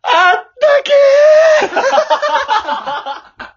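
piercingly high, long-held yell; all-out drawn-out holler; full-volume shout. Pretend. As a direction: scream it out as loud as you can, vowel stretched. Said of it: "大声で。".

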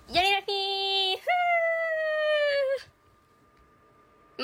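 high, slow party whoop; hyped party shout. Shown as a demonstration. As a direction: yell it like a hyped party girl. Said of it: "なんやらフィーじゃなくてこうだよ".

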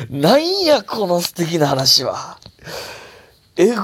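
high, loud exclamation, stretched at the end; excited mock outrage. Real, not acted. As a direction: cry out, bowled over by a sweet story. Reacting to sweet listener story. (興奮したツッコミ)

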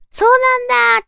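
high, loud amazed gasp; surprised. Pretend. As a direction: act out being amazed. Imagined listener's surprised reaction. (驚いた「そうなんだ」)

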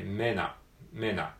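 "mena" with men and at fused together; linked sounds. Shown as a demonstration. Teacher shows how "men at" links into "mena". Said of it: "合体して".

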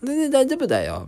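high, put-on reassuring doctor; doctor's voice pitched well up. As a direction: imitate the doctor saying it's nothing. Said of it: "みたいな".

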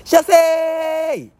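all-out opening shout; full-force shout. Shown as a demonstration. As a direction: yell it out high and loud. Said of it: "本域の射精から始めてみてくれ". (本気の叫び)

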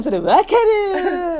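squealed through laughter; teasing the host with exaggerated agreement; laughing loud gush. Real, not acted. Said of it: "うるさいのよ".

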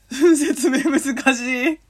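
laughing helplessly. Real, not acted. Host flustered by explaining pronunciation, laughing helplessly.

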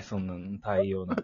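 ordinary talk

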